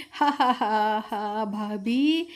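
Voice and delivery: slow teasing ha ha ha; drawn-out mocking chuckle; mock laugh. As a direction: laugh at her sister-in-law playfully. Anjali teasing her sister-in-law.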